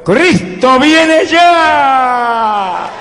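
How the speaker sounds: shouting Christ comes. Retold or imitated. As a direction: cry it out to the people, stretching every word. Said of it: "que le grita a mi pueblo". (grito muy alto y lento, alargando cada palabra)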